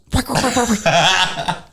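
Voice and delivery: high, rapid noise of nails zipping past; imitated whistling nails. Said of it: "you can hear 'em whistling".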